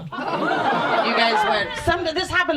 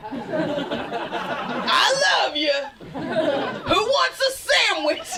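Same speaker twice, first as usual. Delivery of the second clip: angry Merry Christmas; 4 semitones higher, much slower than usual. Retold or imitated. Mad dad yelling holiday wishes, angry shout. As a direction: bark the greeting like it's a threat. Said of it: "same tone".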